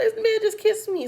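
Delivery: put-on a girl's high-pitched indignant cry to her brother; outraged telling. She tells her brother, upset.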